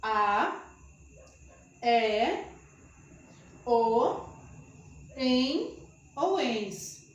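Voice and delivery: "A, E, O, em ou ens" spaced and slow; slow dictation. Shown as a demonstration. Teacher dictates the endings slowly to students. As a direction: spell out each ending with pauses. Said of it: "vamos lá, alunos".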